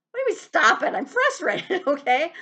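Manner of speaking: loud, high mock outrage; a hammed-up frustrated shout; indignant outburst. Acted outburst at being told.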